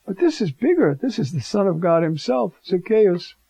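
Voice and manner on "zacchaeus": ordinary delivery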